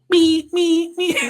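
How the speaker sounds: high electronic beep imitation; alarm beeps. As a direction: mimic a hospital panic alarm, high.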